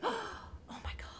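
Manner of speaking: breathless, high, whispered oh my God; panicked whisper. Retold or imitated. Whispering to herself in panic over her new baby. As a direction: whisper it in sudden dread.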